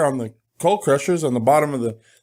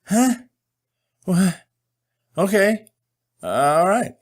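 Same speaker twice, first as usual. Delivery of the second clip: confused; 5 semitones higher, slower than usual. Retold or imitated. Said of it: "I was like".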